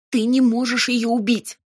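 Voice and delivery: high, urgent protest; piercing cry. A narrator's reading. Robin defending Amalia from the demon, piercing cry. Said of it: "пронзительно вскрикнула я".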